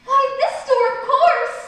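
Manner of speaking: loud, exasperated retort. Acted. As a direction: snap back in disbelief. Alice exasperated at Footman.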